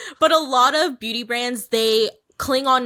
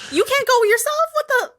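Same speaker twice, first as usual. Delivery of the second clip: very high-pitched outraged disbelief; incredulous; 4 dB louder than usual. Real, not acted. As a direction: shoot the question up high in disbelief. Shocked at dance ticket costs.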